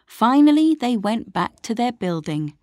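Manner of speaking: plain reading voice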